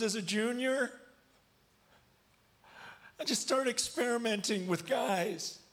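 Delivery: high, tearful strained confession; retold bawling. Bawling football player confessing to youth group, voice pitched high. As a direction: imitate a boy forcing words out through tears. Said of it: "He starts bawling".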